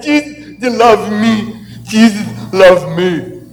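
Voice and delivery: a hint of high, slowed, laughing joy; lightly voiced Bernie. Bernie telling the boys who mocked him, lightly joyful. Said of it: "He said".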